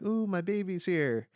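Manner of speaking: high, doting grandmother coo; imitated mother fussing over baby; cooing mom. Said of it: "my mom h-, held my baby and she was like".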